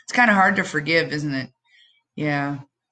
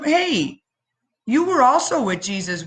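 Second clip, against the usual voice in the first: put-on lightly high girlish voice, pointed question; a hint of a curious young girl; young girl accusing; 7 semitones higher than usual. A lightly voiced shepherd girl confronting Peter.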